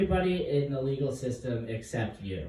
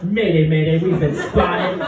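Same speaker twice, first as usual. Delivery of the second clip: louder urgent radio alarm; mock mayday; 3 semitones higher, 8 dB louder than usual. Comic radioing his team about being spotted. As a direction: call the mayday half laughing.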